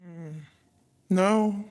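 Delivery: ordinary talk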